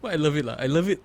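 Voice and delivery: ordinary delivery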